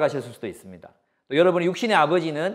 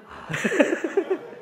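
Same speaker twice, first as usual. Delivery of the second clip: chuckle. Real, not acted. Laughs about hamburger.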